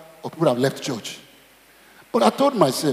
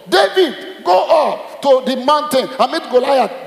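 high, slow, loud proclaiming shout; mock prophet's call; 6 semitones higher, 4 dB louder, slower than usual. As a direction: boom it out like a prophet giving orders.

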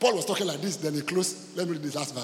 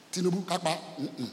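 ordinary delivery

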